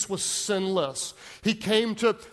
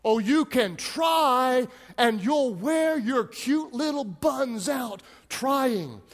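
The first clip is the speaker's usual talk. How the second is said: preaching; 4 semitones higher than usual. Acted. Pastor building a refrain to his congregation, emphatic preaching. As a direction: drive each "that group can't stand" home to the crowd.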